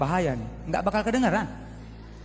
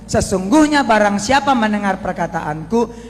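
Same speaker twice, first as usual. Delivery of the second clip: slow, loud, weighty reading; solemn scripture reading; 3 semitones higher, 9 dB louder, slower than usual. Acted. Preacher reads the verse aloud to the congregation, slow and solemn. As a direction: proclaim the verse slowly.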